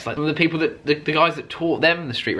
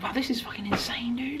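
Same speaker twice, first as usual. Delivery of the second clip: awed whisper; 8 semitones higher, 10 dB quieter than usual. Retold or imitated. Awed inner thought in car.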